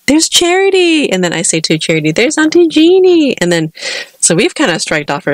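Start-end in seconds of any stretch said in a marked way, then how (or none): 0.1-1.1 high, sing-out delighted greeting; imitating a delighted auntie spotting a baby; excited greeting. Cashier greets baby in retold scene. 2.1-3.4 put-on high-pitched sing-out to a small child; acted, delighted mommy voice; excited pointing-out. Mother points out auntie to child.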